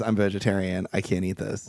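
mock girlfriend voice. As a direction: drop the voice low and play her prissy refusal. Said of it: "she says".

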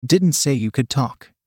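plain reading voice

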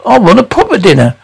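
usual speaking voice

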